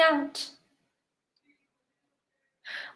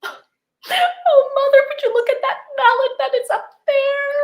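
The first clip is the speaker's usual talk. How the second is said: tearful plea; 12 semitones higher than usual. Acted. Tearful daughter points at mallet.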